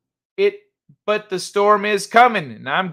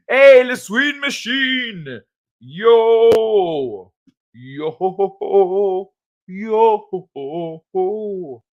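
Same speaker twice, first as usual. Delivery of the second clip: high, drawn-out shout of greeting; excited hailing; 3 semitones higher, 3 dB louder, much slower than usual. Real, not acted. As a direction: call out long, high cheers. Hails someone arriving in chat.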